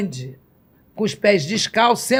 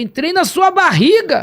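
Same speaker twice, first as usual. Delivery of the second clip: amazed exclamation; 8 semitones higher, 5 dB louder than usual. Retold or imitated. Retold amazed remark to Jesus.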